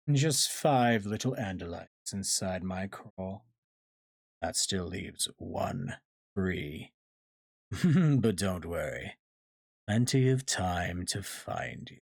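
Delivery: slow, unhurried villain voice with a chuckle; gloating villain. Acted. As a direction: toy with the prey, savoring each pause. Villain gloats over captives.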